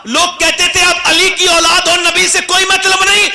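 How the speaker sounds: impassioned preacher crying out to the faithful; preaching at full force. Acted. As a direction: rouse the crowd, voice soaring and shouting. Preaching climax to the crowd.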